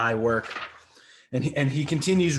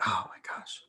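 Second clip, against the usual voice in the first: hushed, voiceless mock shock; imitating a stunned reaction, very soft; stunned whisper; 5 semitones lower, 10 dB quieter than usual. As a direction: breathe it out under the breath, aghast. Acts the character's stunned reaction.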